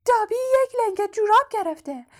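disbelieving house-elf, very high-pitched voice; Dobby. A narrator's reading. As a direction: squeak it in sheer disbelief. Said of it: "دابی ناباورانه گفت".

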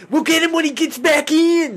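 loud, very high mock-Australian yell; bad Australian accent. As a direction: shout it in a botched Australian accent, almost Cockney. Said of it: "like weird, like, bad Cockney".

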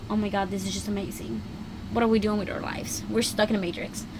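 soft, quick musing to oneself; hushed wonder. Acted. Voicing thoughts while looking at the stars, hushed wonder. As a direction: murmur it in acted awe.

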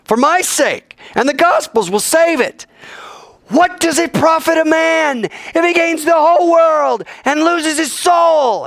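high, loud proclaiming cry; loud mountaintop proclamation, slowing at the end; preached shout. Retold or imitated. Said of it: "he's screaming this from the top".

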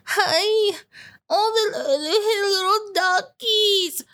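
put-on sing-song high baby talk to the duckies; mocking baby-talk parent. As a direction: coo at the ducks like a doting parent mocking them. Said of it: "Go there with the kids and".

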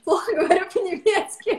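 laughing at her own German, voice jumping high; embarrassed laugh through the words; self-mocking giggle. Real, not acted. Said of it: "когда камера включается".

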